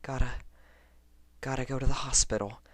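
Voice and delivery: quavering. A narrator's reading. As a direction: talk yourself into it, trembling. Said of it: "voice quavering".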